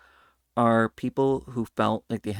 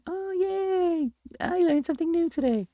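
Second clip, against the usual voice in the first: put-on very high, gleeful child voice; little kid. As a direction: squeal like a delighted toddler. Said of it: "as a little kid going".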